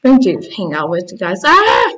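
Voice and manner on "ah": short high-pitched yelp; startled yelp. Pretend. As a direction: yelp as if suddenly spooked. Startled in the game.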